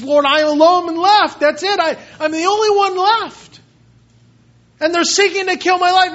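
high, loud, desperate lament to God; despairing Elijah. Acted. Preacher voicing Elijah complaining to God: desperate high lament. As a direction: cry out like the last faithful prophet. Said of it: "and he says".

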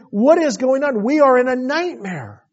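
high, loud alarmed outcry; panicked disbelief. Retold or imitated. Brothers find money in sacks, high alarmed cry. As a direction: cry it out like a bad dream. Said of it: "and they're just like".

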